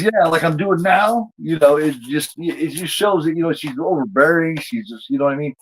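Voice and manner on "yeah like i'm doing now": sarcastic jab. Retold or imitated. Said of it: "doing an imitation of her".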